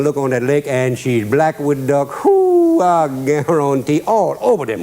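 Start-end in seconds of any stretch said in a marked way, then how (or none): 2.2-2.8 a high, loud whoop of delight at the ducks; excited whoop. Retold or imitated. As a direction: let out a sudden, soaring whoop. Excited at lake full of ducks.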